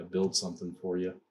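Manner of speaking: ordinary talk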